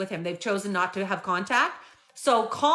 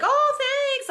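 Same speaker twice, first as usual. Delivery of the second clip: high, bright mock thrill; all excited; 4 dB louder than usual. Mother opening birthday gifts, imitated as all excited. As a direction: gush like a mom thrilled with gifts. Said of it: "like, all excited".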